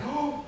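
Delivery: high, hushed shocked gasp; aghast gasp. Retold or imitated. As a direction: imitate a crowd gasping in shock.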